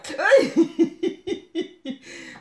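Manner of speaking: high delighted laughter; giddy laugh. Real, not acted. Vlogger laughing at her own purple obsession.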